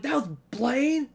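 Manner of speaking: high, slow disbelieving question; surprised. Retold or imitated. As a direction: react in stunned disbelief. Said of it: "I was like".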